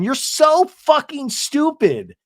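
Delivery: high, slow mock contempt; retold scornful insult. Acted insult at dinner.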